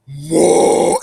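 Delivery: loud, drawn-out bellow; imitated yell. Imitating the face that kept yelling, loud drawn-out cry. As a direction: bellow it long on one note. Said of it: "it kept yelling".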